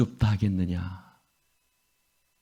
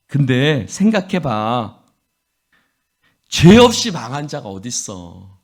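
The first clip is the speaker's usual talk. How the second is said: loud, sneering accusation building up; sarcastic rebuke; 5 dB louder than usual. Acted. Eliphaz taunting Job, loud and sarcastic. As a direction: taunt him, getting louder. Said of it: "비꼬는 말투라고 그러죠".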